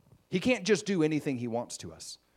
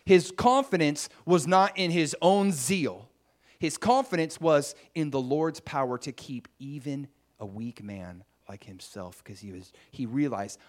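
normal talking voice

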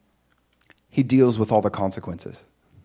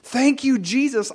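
much higher, joyful shouting; overjoyed. Retold or imitated. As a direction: cry out in happy amazement. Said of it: "I was like".

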